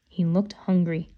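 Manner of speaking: ordinary delivery, nothing marked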